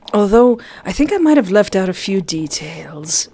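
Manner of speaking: ordinary talk, nothing marked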